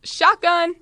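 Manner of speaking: high, loud "shotgun" shout; called out. A narrator's reading. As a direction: call it out loudly across the house.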